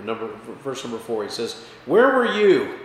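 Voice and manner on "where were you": louder and higher, thundering question; God demanding an answer, booming; booming challenge. Acted. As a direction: boom it down at Job like God. God's booming challenge to Job.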